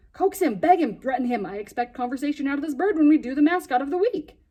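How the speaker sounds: loud, bossy orders; rally your team hard; a boy ordering his friend to make the bird talk; commanding. Acted. Boy ordering friend before air.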